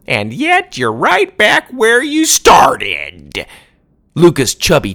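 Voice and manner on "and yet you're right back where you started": put-on scornful old woman. Grandma scolding villain.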